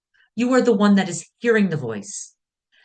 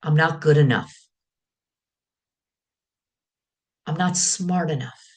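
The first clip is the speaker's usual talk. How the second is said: inner critic voice. Pretend. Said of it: "just listen to my voice".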